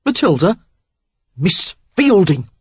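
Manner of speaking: high, loud shocked question; stunned disbelief; aghast. A narrator's reading. Worthy aghast.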